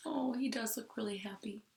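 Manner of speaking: soft whispered aside. Real, not acted. Teacher whispering an aside to the class. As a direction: lean in and whisper it.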